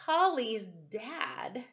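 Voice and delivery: plain reading voice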